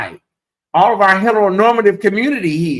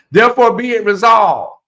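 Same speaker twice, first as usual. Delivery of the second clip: mock formal proclamation; 5 semitones higher, 6 dB louder than usual. Formal resolution catchphrase.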